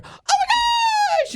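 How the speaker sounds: high squealing "oh my gosh"; wife spotting the yard bee, excited shout. Retold or imitated. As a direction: squeal with delight. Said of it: "she's so excited".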